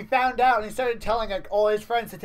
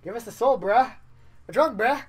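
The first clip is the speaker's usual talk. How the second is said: louder, higher echo of the line; loud repeat; 4 semitones higher, 6 dB louder than usual. Shown as a demonstration. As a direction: throw the line back, louder.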